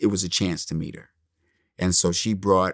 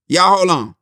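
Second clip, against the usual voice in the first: loud, raised call to halt; 9 semitones higher, 7 dB louder than usual. Retold or imitated. Actress halting the crew, calling out. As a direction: pitch it up and out to a group.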